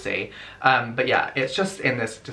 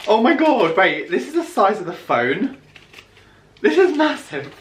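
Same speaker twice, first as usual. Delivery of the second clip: high, loud astonishment; amazed; 8 semitones higher, 5 dB louder than usual. Real, not acted. Vlogger seeing how big the phone is, amazed. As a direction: exclaim in disbelief, pitch jumping up.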